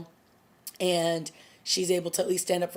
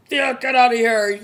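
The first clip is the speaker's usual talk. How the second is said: loud, higher-pitched mock yell; imitated yelling; 6 semitones higher, 10 dB louder than usual. Oliver ordering Thea out of the lair, imitated yelling. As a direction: yell it as someone else's angry order. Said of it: "She's just yelling".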